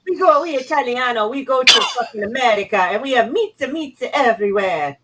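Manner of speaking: hammed-up fake Italian accent. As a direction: ham up a cartoon Italian. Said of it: "What the hell was that".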